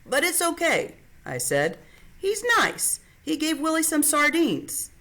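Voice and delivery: put-on high, bright young girl; girl's reassuring voice. A narrator's reading. Sister calms her worried brother in a high girlish voice. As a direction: lift the pitch for a young girl reassuring him.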